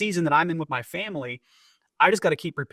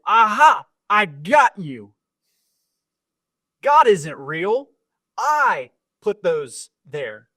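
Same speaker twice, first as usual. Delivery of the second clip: slow, taunting gotcha voice, louder; gloating neighbor; 3 semitones higher, 5 dB louder, much slower than usual. Retold or imitated. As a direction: drag it out like you caught her. Atheist neighbor gotcha retold.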